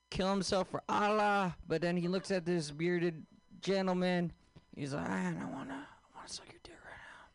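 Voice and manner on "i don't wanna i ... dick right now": breathless, rushed whisper of a secret; whispered hijacker. Retold or imitated. Hijacker whispering his desire to bearded man, fast. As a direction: hiss it out like a forbidden confession.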